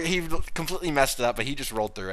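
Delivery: normal talking voice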